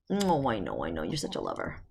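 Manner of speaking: low, soft loving murmur; tender coo to dog. Real, not acted. Telling her dog Angel she is a lover, soft and low. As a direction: murmur fondly to a cuddling dog.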